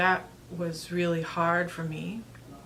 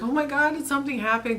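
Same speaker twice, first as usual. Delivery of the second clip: higher, louder alarmed husband asking what happened; husband hearing her sobbing, imitated alarmed and higher; 8 semitones higher, 3 dB louder, faster than usual. Husband on the phone, alarmed, higher and louder. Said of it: "he's like".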